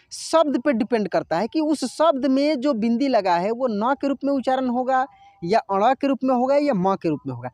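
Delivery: ordinary delivery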